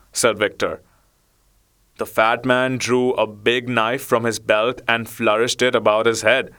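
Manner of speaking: ordinary delivery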